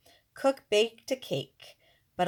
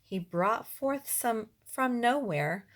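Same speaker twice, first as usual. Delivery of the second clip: ordinary delivery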